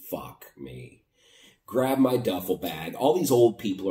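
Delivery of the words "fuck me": low, soft muttered swear under the breath; muttered curse. Retold or imitated. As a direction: curse to yourself so nobody hears. Dismay at being called off bus.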